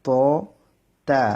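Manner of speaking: "প" thick, then "তা" thin and light; heavy then light letter. Shown as a demonstration. Teacher drilling "প" against "তা", heavy then light. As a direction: contrast a full "প" with a light "তা". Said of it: "মুখের ভিতরে মোটা হয়ে উচ্চারণ হবে".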